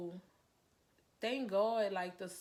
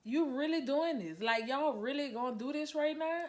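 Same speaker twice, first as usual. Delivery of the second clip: higher, louder pleading disbelief; indignant protest; 6 semitones higher, 6 dB louder than usual. Retold or imitated. Mother being arrested confronts officer, loud and indignant. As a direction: push back hard, half shouting at the officer. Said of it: "And I'm like".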